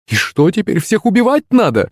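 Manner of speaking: high-pitched scolding wife; indignant cry. A narrator's reading. Wife scolds her husband for shooting, high indignant cry. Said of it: "вскрикнула Лариса".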